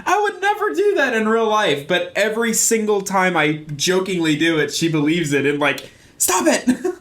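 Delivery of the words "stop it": high-pitched mock protest; mock panic. Wife believing the fake throw, high mock alarm. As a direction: imitate a squealed plea, half laughing.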